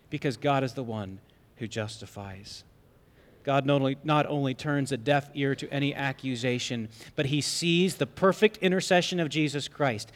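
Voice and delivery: usual speaking voice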